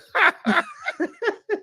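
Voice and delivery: high bursting laugh; hearty real laughter. Real, not acted. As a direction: laugh out at the joke. Laughs at own joke.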